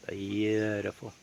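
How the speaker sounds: slow quiet murmur of awe; soft slow repeat. Real, not acted. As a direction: savour the word quietly. Admiring the view twice.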